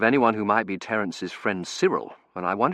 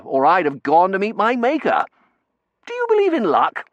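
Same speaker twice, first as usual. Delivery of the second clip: put-on higher, louder chatty young man; cheerful young Terence; 9 semitones higher, 6 dB louder than usual. A narrator's reading. Terence chattering to the narrator on the street, cheerful young voice. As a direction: rattle on cheerfully, full of beans.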